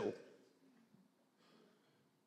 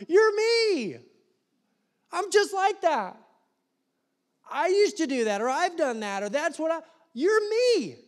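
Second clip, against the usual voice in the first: much higher, louder, beaming embrace; joyful welcome; 12 semitones higher, 5 dB louder than usual. Pretend. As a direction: greet a stranger like a long-lost brother. Joyful believers welcoming someone who failed.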